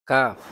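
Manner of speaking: loud কাফ made a little forward of কফ; forward kaf. Shown as a demonstration. Teacher contrasting কাফ with কফ for students, loud. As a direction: say কাফ lighter, tongue further forward. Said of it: "কাফ উচ্চারিত হবে জিহ্বার গুড়া হতে একটু আগে".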